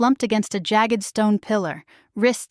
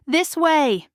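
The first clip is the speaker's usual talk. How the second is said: higher, urgent yell; raised guiding call; 7 semitones higher than usual. A narrator's reading. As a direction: call out to lead the way. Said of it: "I yell".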